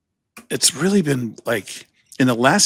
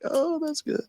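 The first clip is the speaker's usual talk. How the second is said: much higher, mocking falsetto; 8 dB quieter than usual. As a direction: squeak it out like a fake compliment. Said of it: "I was like".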